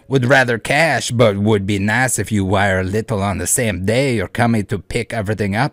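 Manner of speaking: loud, hammed-up French cowboy accent. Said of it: "whatever French cowboy comes out of my mouth".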